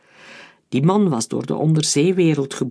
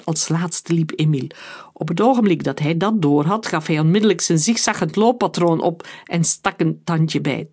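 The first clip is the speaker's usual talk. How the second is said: usual speaking voice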